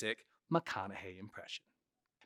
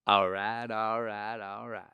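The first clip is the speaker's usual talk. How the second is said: Matthew McConaughey; 2 semitones lower, slower than usual. Shown as a demonstration. Showing the one word, three times, laid-back drawl impression. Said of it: "With McConaughey, it's one word three times".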